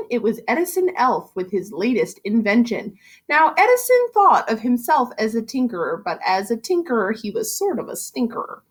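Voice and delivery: put-on lively rhyming read-aloud for kids; story narration. A narrator's reading. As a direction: read it like a bouncy bedtime rhyme. Teacher reads picture book aloud.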